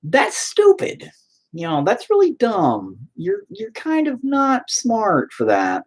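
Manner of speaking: high, slow, exaggerated put-down; mock scolding. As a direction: drag it out high like talking down to her. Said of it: "And I'm like".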